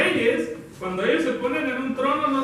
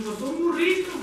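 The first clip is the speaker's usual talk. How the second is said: playful, higher-pitched cheeky reply; mock-innocent ask, pitched up; joking request; 8 semitones higher than usual. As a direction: toss it out as a teasing joke to the boss. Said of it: "bromeando, nosotros le decimos".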